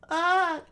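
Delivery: very high, loud cry; sudden falsetto scream of pain; acted yelp. Princess grabbed by the throat.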